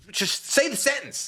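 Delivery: high, sharp New Jersey impatience; hammed-up New Jersey rush; impatient New Jersey voice. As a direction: mimic a pushy New Jersey local hurrying someone. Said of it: "so we're like".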